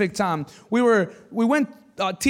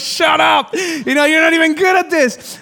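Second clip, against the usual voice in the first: louder, higher mock scolding; snapped shut up; 7 semitones higher, 6 dB louder than usual. Teen driver to crying girl, mock scolding. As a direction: shut her up, exasperated.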